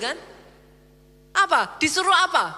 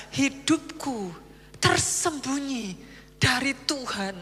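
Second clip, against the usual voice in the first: slow lament; 3 semitones lower, 5 dB quieter, slower than usual. Acted. Quoting Jacob's lament.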